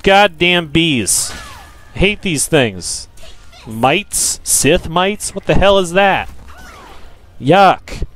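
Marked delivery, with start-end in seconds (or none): none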